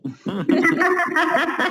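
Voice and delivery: high, loud laughter. Real, not acted. Listener amused by her kids teasing her accent, high laugh. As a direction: crack up at the story.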